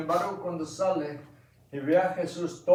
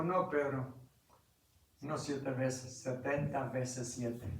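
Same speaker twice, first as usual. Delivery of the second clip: softer and lower, slow at the end; gentle correction; 3 semitones lower, 9 dB quieter, slower than usual. Acted. Jesus gently answering Peter, soft and low. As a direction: correct him gently and calmly.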